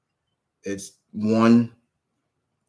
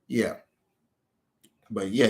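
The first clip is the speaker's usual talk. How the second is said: ordinary talk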